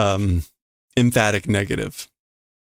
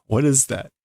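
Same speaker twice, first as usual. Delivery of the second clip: ordinary delivery, nothing marked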